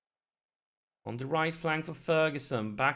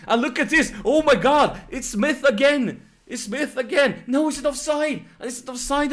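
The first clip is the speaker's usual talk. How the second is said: high, loud shout of disbelief; excited shouting; 12 semitones higher, 10 dB louder than usual. Real, not acted. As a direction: shout the goal call at the top of your voice. Frantic goal call, then offside.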